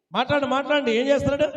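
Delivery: usual speaking voice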